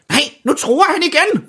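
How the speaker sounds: high, alarmed Santa cry; alarmeret råb. A narrator's reading. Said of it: "råber julemanden". (højt, forskrækket råb)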